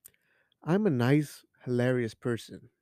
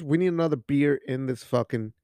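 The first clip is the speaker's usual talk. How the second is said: normal talking voice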